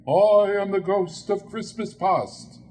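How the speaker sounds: ghost voice. Acted. Ghost introduces itself.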